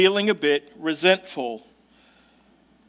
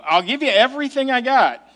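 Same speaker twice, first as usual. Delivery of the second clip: higher, quick, emphatic pledge; all-out offer; 6 semitones higher, 4 dB louder, faster than usual. Retold or imitated. Preacher voicing the people offering everything to God, emphatic. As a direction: offer it all in an urgent rush. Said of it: "they're saying".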